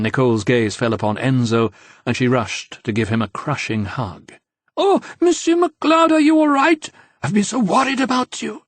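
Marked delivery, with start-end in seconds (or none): 4.8-8.6 very high, loud breathless gush; shrill-pitched fawning admirer voice, much higher and louder; gushing young woman. A narrator's reading. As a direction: throw yourself at him with over-the-top worry. Nicole gushes over Enzo.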